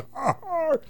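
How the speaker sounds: high hearty pirate laugh; pirate cackle. Retold or imitated. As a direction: cackle like a sea dog. Pirate laughs at own tale.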